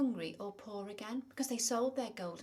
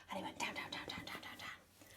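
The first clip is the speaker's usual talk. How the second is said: plain reading voice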